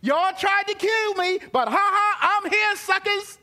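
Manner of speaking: loud, high mock gloat. Joseph imagined gloating at his brothers, loud and mocking. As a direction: crow over them like you won. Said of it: "him being glad to say".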